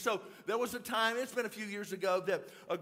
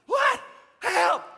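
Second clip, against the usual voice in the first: high, very loud mock panic; panicked yelling; 11 semitones higher, 11 dB louder, much slower than usual. Trey in the river, imitated panicked yelling. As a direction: yell it in short, hammed-up bursts.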